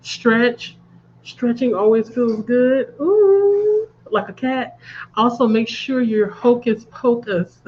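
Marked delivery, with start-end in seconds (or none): none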